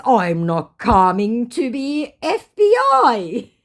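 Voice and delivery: loud, high, slow mock voice; mocked scammer. Reading the scammer's message to viewers, mocking. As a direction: drag out the scammer's line mockingly.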